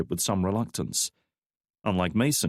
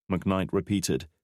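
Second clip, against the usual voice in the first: plain reading voice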